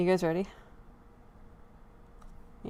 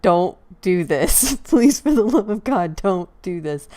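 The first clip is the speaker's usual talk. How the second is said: mock scolding plea; 8 dB louder, slower than usual. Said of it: "last night you kinda scolded me".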